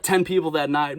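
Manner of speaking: ordinary delivery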